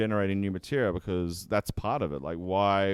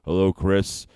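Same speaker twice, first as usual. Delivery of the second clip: low, louder put-on character voice; 3 semitones lower, 6 dB louder, slower than usual. Shown as a demonstration. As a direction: drop into a deeper character. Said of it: "you can change your voice".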